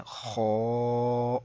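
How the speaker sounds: خو said thick and full; heavy kho. Shown as a demonstration. Teacher shows a heavy letter, thick full خو. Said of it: "موٹی آواز میں پڑھا ہے".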